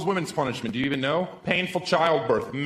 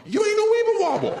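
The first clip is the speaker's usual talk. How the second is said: much higher, loud taunting yell; 4 dB louder than usual. Retold or imitated. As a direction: scream the taunt over the crowd. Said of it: "where I can yell".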